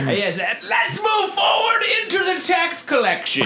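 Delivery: high laughing announcement; mock announcer. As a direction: proclaim it through laughter. Mock tax collection announcement.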